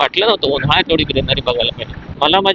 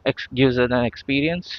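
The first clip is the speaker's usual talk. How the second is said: ordinary delivery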